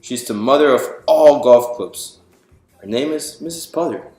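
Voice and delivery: ordinary delivery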